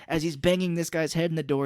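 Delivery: normal talking voice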